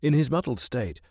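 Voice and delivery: plain reading voice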